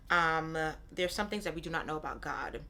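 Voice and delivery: ordinary talk, nothing marked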